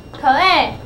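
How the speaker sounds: "可爱" as two sounds, k then long A; sounding out. Shown as a demonstration. Teacher blending the word for a pupil, sounding out. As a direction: sound out "可爱", a short kuh before letter-name A. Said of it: "所以这个字就是".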